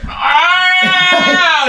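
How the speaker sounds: long, high-pitched mock yell; imitated panicked scream. Hunter mimics what he heard after the shot, panicked yell. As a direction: let out a drawn-out retold scream. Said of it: "you're in a panic".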